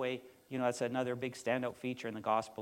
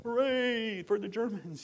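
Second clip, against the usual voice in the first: a high, slow cheer in mock joy; 10 semitones higher, slower than usual. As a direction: play the crowd's naive cheer. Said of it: "they were all like".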